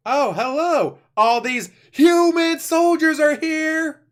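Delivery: high, loud, slow mock yell of a warning; hammed-up yell to warn someone who is far off; a big retold shout, drawn out; imitated yell. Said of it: "he's yelling something".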